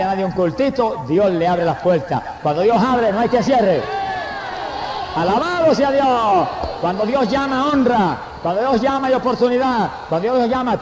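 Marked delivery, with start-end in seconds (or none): 2.4-3.8 fiery preaching. Real, not acted. Preaching climax, repeated refrain. 5.1-6.5 slow, high, loud cry of praise; praise shout. Real, not acted. Preacher shouts praise at climax. 6.8-10.8 fiery preaching. Real, not acted. Preaching climax, repeated refrain.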